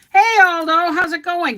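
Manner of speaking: high, loud hello; cheery greeting. Real, not acted. Streamer greeting chat viewer Aldo, high and loud. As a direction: call the hello out brightly.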